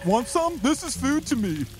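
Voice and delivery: high, dopey creature voice; Sasquatch voice. Acted. Sasquatch chatting with Bello, high dopey voice. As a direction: play the dim helpful Sasquatch. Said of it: "he says".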